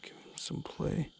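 whispered under the breath. Real, not acted. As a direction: hush it so the fish isn't spooked.

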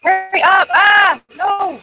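high frantic shouting; panicked scream. Real, not acted. Panic while chased.

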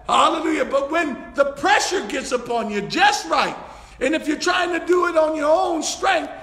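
urgent, impassioned pulpit shout; full-force preaching. Real, not acted. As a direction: exhort the congregation with mounting fervor.

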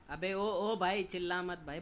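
normal talking voice

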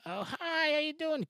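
high, sing-out hello; put-on high-pitched friendly hello; gushing greeting. Acted greeting of Ashley Graham.

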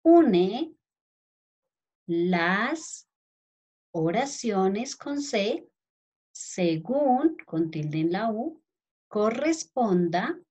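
words set apart by long pauses, spelling cues tacked on; teacher dictating a question; slow dictation. Shown as a demonstration. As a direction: dictate slowly so children can write each word. Dictating instruction.

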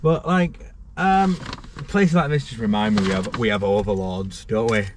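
ordinary talk

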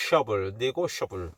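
"셔블" opening on a long sh, then the whole "니고셔블"; correct sh demo. Shown as a demonstration. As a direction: isolate "셔블" with a held sh before "니고셔블". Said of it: "쉬 발음이 납니다". ("셔블"의 sh 소리를 길게 끌어 발음)

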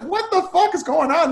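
mock bewilderment. Driver tells the vet about a crazy guy, acted bewilderment. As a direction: exclaim it high, as if baffled.

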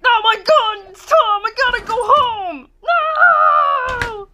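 high, loud panicked cry; frantic high-pitched alarm. Pretend. Panics as Tom appears.

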